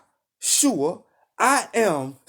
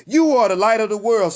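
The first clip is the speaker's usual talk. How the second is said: preached louder; 5 semitones higher, 5 dB louder than usual. Acted. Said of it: "Let's say it one more time".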